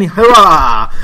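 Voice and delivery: loud playful hurrah for a toast; mock toast cheer. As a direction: raise a glass and cheer it out loud. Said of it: "I was getting ready for a toast then".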